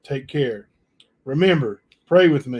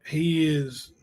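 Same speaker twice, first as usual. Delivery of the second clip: ordinary talk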